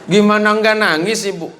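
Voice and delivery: usual speaking voice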